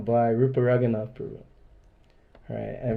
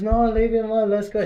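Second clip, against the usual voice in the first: put-on girlfriend pleading; 10 semitones higher, 6 dB louder than usual. Girlfriend voiced pleading to leave.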